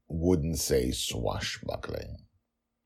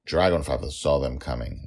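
usual speaking voice

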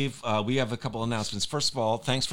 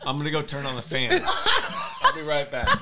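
laughing hard; 3 dB louder, faster than usual. Real, not acted. Fart in the room, laughing.

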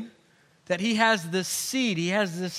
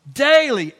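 a loud, falling "Daily"; emphatic daily; 4 semitones higher, 8 dB louder than usual. Real, not acted. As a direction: punch "Daily" as the answer. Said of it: "Take up your cross".

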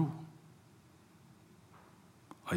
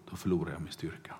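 hushed secret; 5 semitones lower, 9 dB quieter, much faster than usual. Retold or imitated. Samson reveals secret to Delilah.

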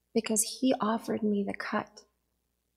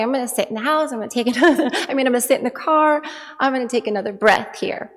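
loud, strained calm resolve; forcing herself to breathe; mock calm; 8 dB louder, faster than usual. Wife announcing to family, loud forced calm. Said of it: "So I say".

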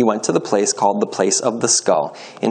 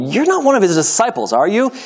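higher-pitched probing question, rising at the end; suspicious questioning; 9 semitones higher, 3 dB louder than usual. A narrator's reading. Questioner asks Peter about being a disciple, suspicious questioning. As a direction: press him with a suspicious question.

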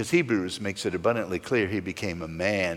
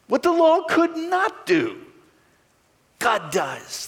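high proclaiming reread of the verse; soaring high reread. Shown as a demonstration. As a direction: lift the whole line much higher, ringing. Reread with God does.

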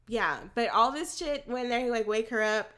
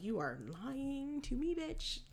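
quiet disbelief; 2 semitones higher, 10 dB quieter, slower than usual. Retold or imitated. Reacting to Jell-O food.

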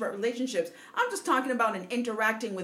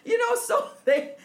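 laughing through words; 3 semitones higher than usual. Real, not acted. Laughing while talking.

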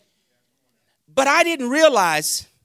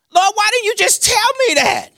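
high-pitched pleading complaint; exasperated protest; 9 semitones higher, 4 dB louder than usual. Retold or imitated. Preacher asking God why He didn't say, exasperated protest.

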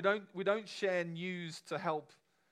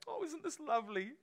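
much higher, delighted cry; delighted exclamation; 12 semitones higher than usual. Retold or imitated. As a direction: gush with delight. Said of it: "he doesn't stop there".

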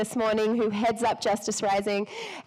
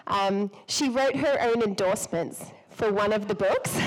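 ordinary talk

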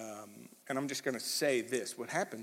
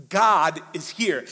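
each word hit, slow and louder; slow emphatic proclamation; 4 semitones higher, 11 dB louder, much slower than usual. Real, not acted. Preacher declaring to the congregation God is here, slow and emphatic. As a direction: land it like a revelation.

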